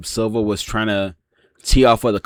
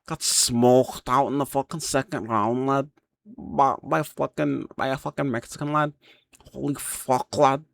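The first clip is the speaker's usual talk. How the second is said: put-on Liverpool Scouse voice, higher, ending lines with lad; Scouse accent; 5 semitones higher than usual. MMA fan recapping Campbell's loss, in Scouse accent. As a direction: lay on a thick Scouse accent, pitched up. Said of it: "let me get my Scouse accent".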